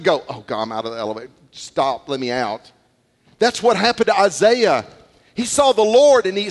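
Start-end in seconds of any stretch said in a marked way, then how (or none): 0.3-2.7 mock panic. Lady in elevator smelling.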